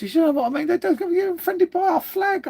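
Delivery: squeaky disbelief at the council; indignant rant. Real, not acted. Griping to viewers about the flag, voice jumping high in outrage. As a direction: voice shooting way up in outrage.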